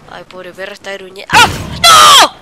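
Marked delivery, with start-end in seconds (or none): 1.3-2.4 loud, high cry of protest; startled shout. Real, not acted. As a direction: yell out in sudden dismay. Dies or is hit in game.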